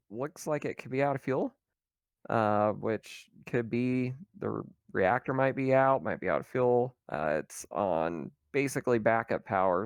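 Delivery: usual speaking voice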